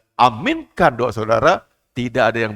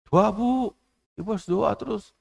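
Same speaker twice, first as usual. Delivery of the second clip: put-on higher, light child's plea; child urging; 10 semitones higher than usual. Little Samuel urging grandmother to pray, lightly voiced child. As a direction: nudge grandma to keep praying. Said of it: "child urging grandmother".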